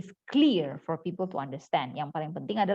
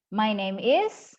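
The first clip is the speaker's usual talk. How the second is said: "My name is" slowly, climbing on "is"; rising intonation; much slower than usual. Shown as a demonstration. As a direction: lift "is" up like a question. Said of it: "bilangnya kayak gini".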